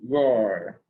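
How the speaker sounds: loud playful dinosaur roar. Pretend. Child reading a dinosaur book aloud, loud playful roar.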